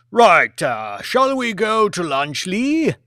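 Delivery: change the subject briskly; Lord Martin's voice. Acted. Lord Martin moves Lee on to lunch, loud and brisk.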